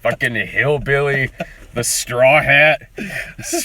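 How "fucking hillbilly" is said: mock hillbilly accent. Mocking a Southern voice, hillbilly.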